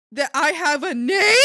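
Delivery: loud, rising disbelieving yell; indignant shout. Real, not acted. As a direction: shout it as an absurd question. Disbelief at coworkers complaining.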